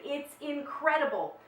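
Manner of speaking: ordinary talk, nothing marked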